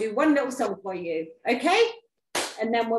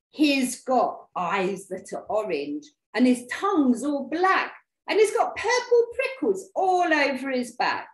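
building louder and higher; scary description; 6 semitones higher, 6 dB louder than usual. Acted. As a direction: paint the monster with mounting drama. Mouse describes monster to frighten snake.

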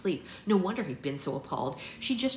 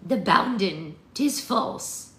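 put-on loud, raised rustic old voice; old woman's warning; 4 semitones higher, 9 dB louder, much slower than usual. A narrator's reading. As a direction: repeat the omen forcefully, pitched up. Old woman repeats her warning.

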